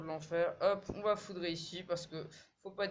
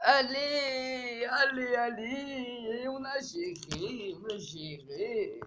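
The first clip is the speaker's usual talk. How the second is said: drawn-out loud high celebration; slow triumphant cheer; 6 semitones higher, 6 dB louder, much slower than usual. Real, not acted. Streamer celebrates the win, slow loud cheering. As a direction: stretch each allez, cheering.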